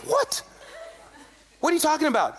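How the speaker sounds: high-pitched, baffled retort; startled disbelief. Retold or imitated. Storyteller replaying his answer to his angry friend, startled. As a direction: act out being taken aback.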